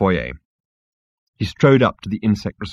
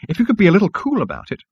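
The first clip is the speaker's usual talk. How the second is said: higher, fast, snippy insect; 11 semitones higher, faster than usual. Acted. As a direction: snap it back quickly and prissily. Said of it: "snapped the fragile little creature".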